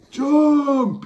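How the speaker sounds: very high, loud pirate yell. Acted. Pirates ordering the Trawl off the plank, yell. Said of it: "yelled the pirates".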